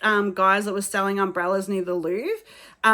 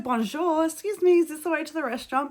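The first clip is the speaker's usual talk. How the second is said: higher, sugary bonjour with a big smile; sweet polite tourist; 6 semitones higher, faster than usual. Retold or imitated. Asking a security guard for directions with a big smile, sugary. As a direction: ask it as sweetly as you can. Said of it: "Like, I said".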